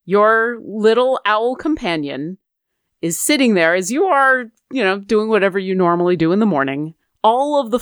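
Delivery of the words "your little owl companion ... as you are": ordinary delivery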